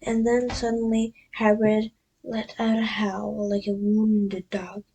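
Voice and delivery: ordinary delivery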